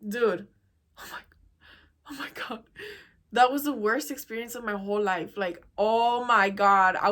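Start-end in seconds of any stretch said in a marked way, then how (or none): 1.0-2.6 breathless whisper of disgust. Real, not acted. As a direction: whisper it, appalled. Recalling disgusting night.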